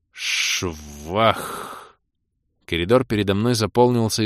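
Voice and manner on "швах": long-drawn spell whoosh; whoosh noise. A narrator's reading. Poison wave bursts out, whooshing sound effect. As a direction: voice the splash of a spell.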